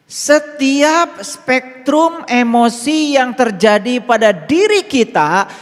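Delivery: slow, high, loud preaching with every word leaned on; forceful preaching. Acted. Teacher preaching to his followers, slow, high and loud. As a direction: proclaim it to the whole room.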